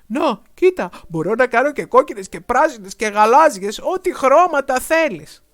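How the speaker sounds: loud, high, eager dragon; eager showing off. A narrator's reading. Dragon delighting the boy with bubbles, loud and eager. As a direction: show off every colour.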